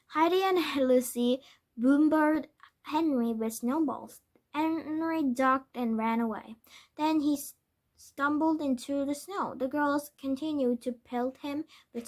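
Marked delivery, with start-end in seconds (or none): none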